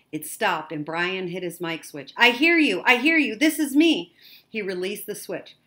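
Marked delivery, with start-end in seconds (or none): 2.2-4.1 high, loud, eager answer; desperate relief, pitched up and loud; urgent radio reply. A narrator's reading. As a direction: shout back so the rescuer hears you.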